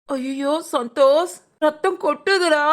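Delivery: drawn-out, slow cry of dismay; alarmed wail. Acted. Seeing Santhosh's blood pouring, drawn-out alarmed wail. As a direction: wail it, stretching each word. Crying out at the bleeding.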